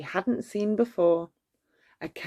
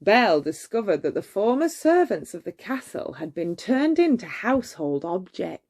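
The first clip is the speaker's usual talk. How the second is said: ordinary delivery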